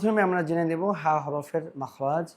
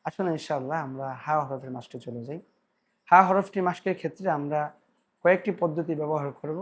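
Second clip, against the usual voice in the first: ordinary delivery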